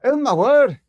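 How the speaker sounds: loud high shout of alarm; alarmed cry. Acted. Staff member seeing doctor collapse, loud alarmed cry. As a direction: gasp it out in shock.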